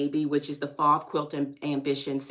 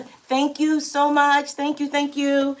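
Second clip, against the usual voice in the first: high, loud gushing thanks; higher, louder thrilled thank yous; excited thanks; 10 semitones higher, 7 dB louder than usual. Real, not acted. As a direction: gush with gratitude.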